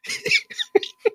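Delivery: high cackle. Acted.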